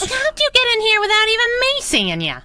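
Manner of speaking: high, startled outburst. Acted. Sidekick startled as the boss appears unseen, high startled outburst. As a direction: caught off guard by the sudden arrival.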